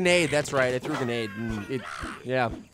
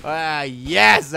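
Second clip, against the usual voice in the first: ordinary delivery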